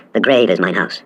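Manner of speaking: plain reading voice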